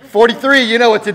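loud, high, hammed-up overeager doctor greeting; shouted mock bubbly doctor; overexcited doctor. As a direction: play a doctor way too pumped to see his patient. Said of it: "Does your doctor walk in?".

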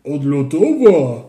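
slow, loud, marvelling; delighted. A narrator's reading. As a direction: savour the word in delight. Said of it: "zachwycił się jakiś grubas". (zachwycony, przeciągnięty)

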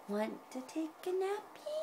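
high, soft kitty invitation; high, gentle cat voice; storybook cat. A narrator's reading. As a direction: ask softly like a little cat. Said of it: "said the cat".

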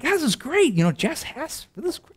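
delighted by the coincidence, voice jumps up; excited. Retold or imitated. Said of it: "And I'm like".

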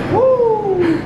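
high giddy laugh and cheer. Real, not acted. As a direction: laugh it off, then whoop. Laughing after monkey bars.

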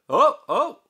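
very high, loud whoa; alarmed shout. A narrator's reading. Meester Jaap shouts at the children, high and loud. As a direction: stop them in panic. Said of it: "roept meester Jaap".

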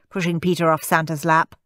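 usual speaking voice

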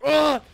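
high, loud "ah"; short rage scream. Real, not acted. Player after cursing the game, short angry scream. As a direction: cry out in frustration.

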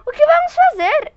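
high, loud frightened question; frightened wife asking her husband what to do; nearly voiceless fear. Acted. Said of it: "quase sem voz".

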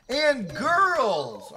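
emphatic. Shown as a demonstration. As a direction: give it some emphasis. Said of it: "Have a little emphasis".

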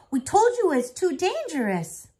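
high, loud worried scolding. Acted. Grandfather scolding the twins, loud. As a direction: scold them out of fear.

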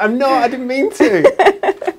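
high, slow, laughing "I didn't mean to"; mock innocent protest. Answering someone who thinks she copies their accent, laughing innocent protest. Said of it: "and I'm like".

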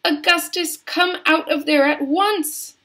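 high, loud scolding order; frantic high-pitched command; yelling mother. A narrator's reading. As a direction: yell up at your son. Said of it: "yelled Missus Gloop".

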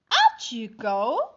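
firm dismissal. Acted. Family throws spider out.

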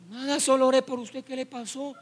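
soft acted answer, fading away; timid reply. Brother explaining he only prayed, soft timid reply. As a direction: answer meekly, dropping to almost nothing.

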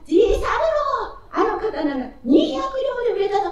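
high, loud, thrilled shout; excited shout. Acted. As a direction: burst out with the good news. Master shouts the good news.

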